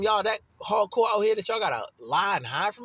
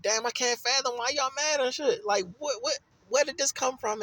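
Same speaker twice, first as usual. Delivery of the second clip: mock bewilderment; 7 semitones higher than usual. Voicing a bewildered listener.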